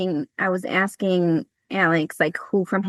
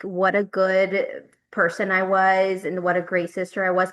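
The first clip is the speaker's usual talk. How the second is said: usual speaking voice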